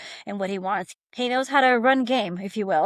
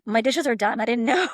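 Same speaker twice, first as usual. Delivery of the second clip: laughing, louder, delighted disbelief; laughing surprise; 5 dB louder than usual. Retold or imitated. Sister laughing at brother's surprise cleanup. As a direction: giggle through it, amazed the chores are done.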